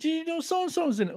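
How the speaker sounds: high, excited mock friend voice; imitating Leroy. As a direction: play an excited friend on the phone, pitched high. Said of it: "He went".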